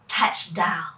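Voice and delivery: ordinary delivery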